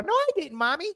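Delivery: put-on high, whiny child denial; mock kid voice. Voicing a daycare kid caught on camera, high whiny child. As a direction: play a fibbing toddler.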